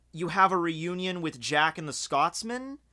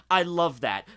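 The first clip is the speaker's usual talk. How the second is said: usual speaking voice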